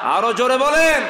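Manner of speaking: very loud, high-pitched rallying shout; urging full shout. Real, not acted. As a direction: bellow it to rouse the whole crowd.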